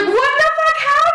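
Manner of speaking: very high, stunned squeal; shocked disbelief. Retold or imitated. Chasing the storming friend, squealing in shock. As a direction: shriek it in total confusion. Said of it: "I'm just like".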